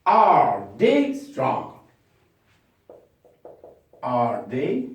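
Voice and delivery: Are they strong, very slow; slow question drill; slow question model. Shown as a demonstration. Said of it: "the question will be".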